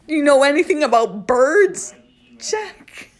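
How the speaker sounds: loud, high taunting question; mock questioning. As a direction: quiz the monkey like a teacher. Mock address to the monkey.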